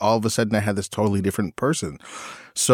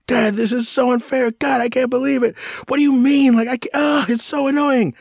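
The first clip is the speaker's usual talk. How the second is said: put-on much higher, whining teenage daughter; whiny teen outburst. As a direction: throw a bratty teen tantrum at dad. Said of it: "she lost it on me".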